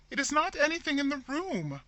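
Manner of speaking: high, loud insistent horror; frightened sister stubbornly repeating the denial; obstinate horror. A narrator's reading. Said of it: "repeated Rebecca with obstinate horror".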